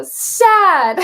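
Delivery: put-on high, loud little-kid "Sad" with a laugh; toddler voice. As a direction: chirp it like a toddler naming a feeling. Mom imitates toddler naming emotion.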